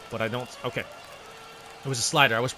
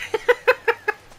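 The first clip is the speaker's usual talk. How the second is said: high, loud burst of laughter; high cackle; 6 dB louder than usual. Real, not acted. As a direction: cackle at your own boast. Laughing at own claim.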